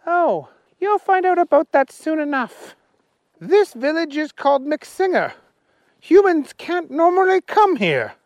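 high, loud little policeman voice; Willie the policeman. Acted. Policeman Willie stopping the humans, high and loud. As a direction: bark orders as a tiny policeman.

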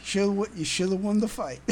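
ordinary talk, nothing marked

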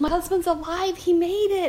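high, loud, bursting with joy; excited news. Retold or imitated. Wife telling the doctor her husband survived, overjoyed and loud. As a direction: announce it like the best news ever.

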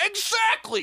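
high, loud exclamation; excited shout. Real, not acted. Reviewer ranting to his co-host, loud excited shout. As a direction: bark it out triumphantly. Ranting about robot villain.

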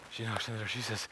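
ordinary delivery

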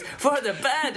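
high, slow, stretched Scottish crowd call; Scottish hype-man accent. Retold or imitated. Singer on stage rousing an emo crowd, in a stretched-out Scottish accent. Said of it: "And then she goes".

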